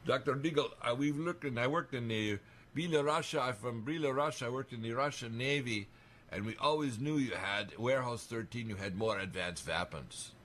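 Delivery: imitated Russian-accented English. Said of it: "with a little accent because he had a Russian accent".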